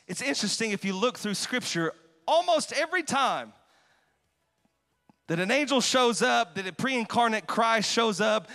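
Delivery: normal talking voice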